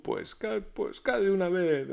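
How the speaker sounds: high, loud stuttering; terrified stammer. A narrator's reading. Terrified Pedro stammers at the ghost, loud and high. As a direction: force the words out through fear. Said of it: "repitió Pedro".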